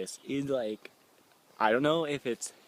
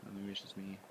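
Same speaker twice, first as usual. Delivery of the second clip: scared hush; 18 dB quieter, faster than usual. Real, not acted. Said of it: "Now I'm getting really freaked out".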